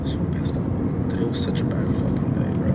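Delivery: whispered, exhausted anger; defeated whisper. Real, not acted. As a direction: whisper it bitterly, voice nearly gone. Venting bad day, drops to whisper.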